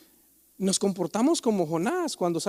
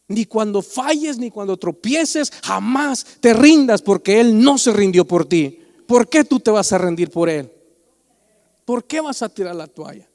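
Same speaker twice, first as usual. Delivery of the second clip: louder, pleading not to quit; fervent exhortation; 6 dB louder than usual. Real, not acted. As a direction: preach it with full force. Preacher urging not to give up. (exhortación fervorosa)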